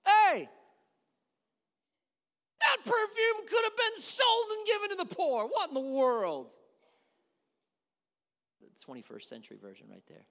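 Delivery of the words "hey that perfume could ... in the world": loud, high-pitched indignant shout; piping-up outburst. Acted. Judas interrupting the worship over the perfume, indignant shout. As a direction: burst in as an outraged Judas. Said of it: "pipes up and goes".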